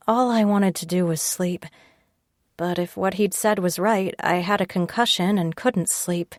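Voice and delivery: usual speaking voice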